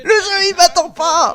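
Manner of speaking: high, drawn-out comic wail; real high-pitched protest; exasperated outcry. Real, not acted. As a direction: wail in helpless exasperation at the game. Complains the game won't wait.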